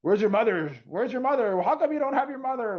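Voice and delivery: put-on scolding a lost child instead of helping; harsh questioning. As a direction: interrogate the lost kid, stern and pushy. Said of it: "you're not gonna be like".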